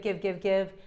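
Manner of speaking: normal talking voice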